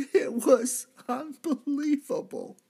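high, tearful half-laugh; overcome. Acted. Thief recalls his belief, high tearful laugh-cry. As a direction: choke it out between a laugh and a cry. Overwhelmed recalling Jesus's promise.

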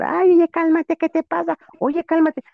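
grito agudo y fuerte, asustada; grito asustado. Retold or imitated. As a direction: grita pidiendo que se calme, muy agudo. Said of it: "empieza a gritar". (a la amiga que la abraza, grito agudo y fuerte)

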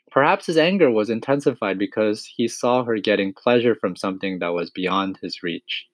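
ordinary delivery

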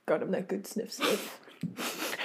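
breathy whispered aside. Pretend. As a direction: hush it like a secret. Whispered joke.

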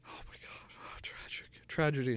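whispered, barely voiced lament; play-acted hushed dismay; hushed mock mourning. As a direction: murmur it like a shocked mourner. Imagined mourners at own farewell.

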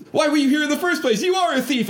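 high, fast accusing shriek, acted out; mock screaming. Woman screaming at the intruder, mock screaming. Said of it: "starts screaming again".

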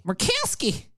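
high-pitched, exaggerated name; mocking name-call. Host naming the senator, mocking high voice.

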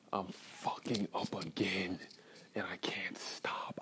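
whispered, slow and broken; slow despairing whisper. Real, not acted. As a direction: barely breathe the words out. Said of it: "I'm holding back tears right now".

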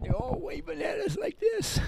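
high, rising delighted voice; childlike glee. Retold or imitated. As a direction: pitch climbs way up, tickled by the waving. Imitating gang members waving like kids.

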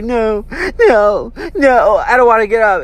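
put-on high, pleading kid voice; whiny refusal. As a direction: act out a child begging not to get up. Retold child refusing to get up.

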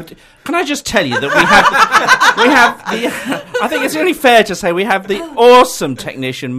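giggling through the words; laughing while talking, high and loud. Real, not acted. Host giggling through studio intro.

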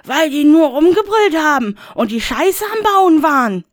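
bursting out. Acted. Rainer bursts out at Death, high and loud. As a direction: blurt it out in rage. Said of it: "platzte es aus Rainer heraus".

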